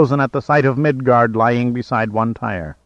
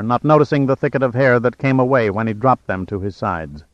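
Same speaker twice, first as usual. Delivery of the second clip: usual speaking voice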